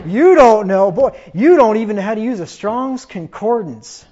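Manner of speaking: loud condescending mockery; belittling scorn. Pharisees belittling a believer, loud condescending scorn. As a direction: sneer down at the unlearned. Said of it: "They want to make you feel stupid".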